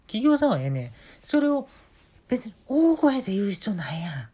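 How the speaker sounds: casual Kansai-ben banter throughout; put-on Kansai accent, lightly done; Kansai dialect. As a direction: chat like a chatty Osaka guy, in Kansai dialect. 全編、あのー、関西弁で行かしてもらいます.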